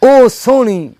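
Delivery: emphatic Punjabi verse with drawn-out key words; poetry recital. Acted. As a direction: declaim it to a mushaira crowd.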